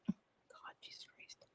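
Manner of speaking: unvoiced whisper under the breath; whispered exasperation. Real, not acted. Host whispering to herself while trying to remember.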